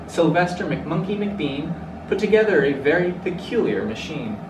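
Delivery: ordinary delivery, nothing marked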